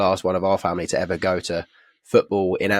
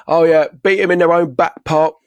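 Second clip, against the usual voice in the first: put-on higher, slowed drunken interview voice; mock drunk boast, drawn out; Brett; 7 semitones higher, 3 dB louder, slower than usual. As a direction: imitate a steaming fan on TV. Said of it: "he was absolutely steaming".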